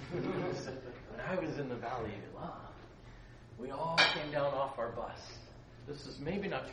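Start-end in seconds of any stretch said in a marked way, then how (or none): none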